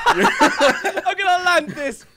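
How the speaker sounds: slow, loud, high shout with laughter; excited yell. Real, not acted. Player mid-jump to friends, slow excited yell. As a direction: holler it out mid-jump, drawn out.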